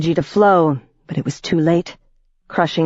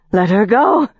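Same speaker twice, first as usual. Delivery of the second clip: higher, louder urgent plea; crying out; 6 semitones higher, 4 dB louder than usual. A narrator's reading. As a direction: cry out to save her friend. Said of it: "she cried".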